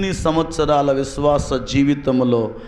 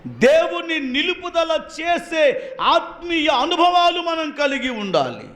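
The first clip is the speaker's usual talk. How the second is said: high, ringing preacher voice at full force; 14 semitones higher than usual. Acted. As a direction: preach it hard to the congregation. (ఉత్సాహంగా బోధించడం)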